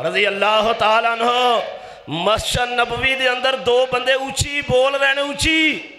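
high, ringing pulpit storytelling; full-force preaching. Real, not acted.

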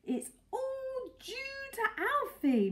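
high, slow, breathless voice; slightly breathless. A narrator's reading. Mrs Silver after the embrace, slightly breathless. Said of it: "slightly breathless".

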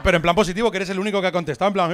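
ordinary delivery

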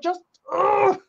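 high, slow, exasperated groan at the actor; mock despair at an accent; exasperated plea. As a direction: beg him to just stop, high and drawn out. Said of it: "I'm like".

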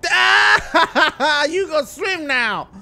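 high, loud laughing taunt; gloating laughter. Acted. Gets revenge by pushing Bobby into the water, gloating. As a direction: laugh loudly, then draw out the taunt.

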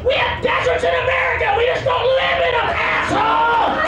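high shouted tirade; hammed-up exasperated yelling; furious rant. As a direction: scold them at full volume. Ranting at desert villagers.

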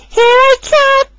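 high, slow, loud; excited cry. A narrator's reading. Rosie charging at the puddle, loud excited cry. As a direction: charge in gleefully. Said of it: "she cried".

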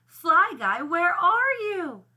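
loud, high searching call; crying out. A narrator's reading. Buzz calls for his fly at the dump, crying out. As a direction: call out for a lost friend. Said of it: "he cried".

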